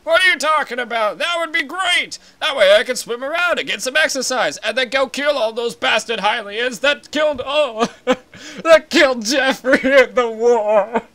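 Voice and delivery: put-on a shouting old advisor, indignant; ranting old Zora elder. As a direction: bluster like an outraged elder. Elder rants again.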